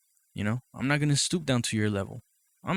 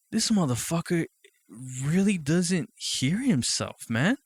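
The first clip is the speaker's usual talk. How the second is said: incredulous thought; 6 semitones higher, slower than usual. Retold or imitated. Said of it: "I'm like".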